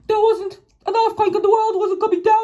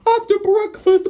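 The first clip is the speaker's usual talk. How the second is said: ordinary delivery, nothing marked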